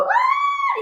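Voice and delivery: sudden high frightened cry; startled yelp. Retold or imitated. Teacher acting out fright at a big dog. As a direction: jump back with a yelp. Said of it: "you go".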